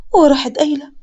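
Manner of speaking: ordinary delivery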